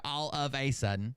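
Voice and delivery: "a" in "all of a sudden" said as "ay"; literal exact reading. Shown as a demonstration. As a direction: give "a" its full letter-name sound, word by word. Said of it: "I'm reading this exactly right".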